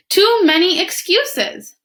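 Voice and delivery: fed up, drawing it out; exasperated. Acted. Iguana scolding her lazy friends, exasperated.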